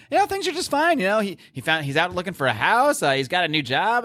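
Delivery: high Abigail voice. Retold or imitated. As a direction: play her brushing it off in a high bright voice. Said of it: "And she's saying".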